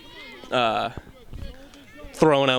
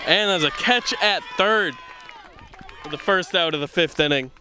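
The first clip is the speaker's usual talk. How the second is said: higher-pitched softball play call; live play-by-play; 5 semitones higher than usual. Real, not acted. Commentator calling a catch at third, live play-by-play. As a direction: call the out with a lifted voice.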